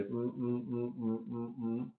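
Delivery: soft, short, evenly spaced "mm" noises; little monotone sound effect; rhythmic humming ticks. Shown as a demonstration. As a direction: tick out a dotted line in quiet hums. Imitates drawing dashed lines.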